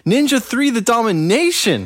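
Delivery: high, thrilled movie-title shout-out; excited. Real, not acted. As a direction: blurt the title in delight.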